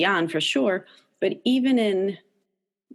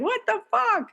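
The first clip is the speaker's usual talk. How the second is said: high incredulous what the; sudden high stunned disbelief. Retold or imitated. Parent stunned as everyone turns away, high disbelief. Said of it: "You're like".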